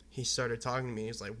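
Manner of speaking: ordinary talk, nothing marked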